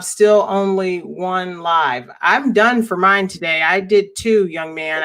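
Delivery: ordinary delivery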